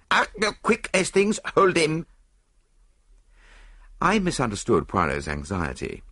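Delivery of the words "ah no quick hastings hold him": put-on high, accented cry of warning; sudden panicked command; urgent alarm. A narrator's reading. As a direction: shout for help to stop him. Doctor makes sudden move, Poirot alarmed.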